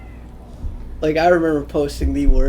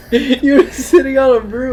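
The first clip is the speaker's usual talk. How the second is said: laughing; 10 semitones higher than usual. Real, not acted. Laughing while accusing friend.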